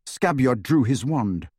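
ordinary delivery, nothing marked

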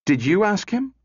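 high, quick-fired demand, rising; shot question. A narrator's reading. Calvin turns on Bogart, shooting a high question. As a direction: fire the question straight at him. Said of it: "she shot at Bogart".

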